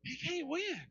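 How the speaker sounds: high, ringing victory cry; triumphant exclamation. Retold or imitated. Preacher retelling his cry to his wife, high and triumphant. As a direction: crow it out with glee. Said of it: "I said, "But".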